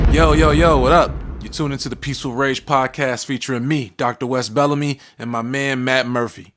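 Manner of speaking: hyped show opener, starting loud; podcast intro. Real, not acted. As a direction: greet the listeners big, then introduce the hosts.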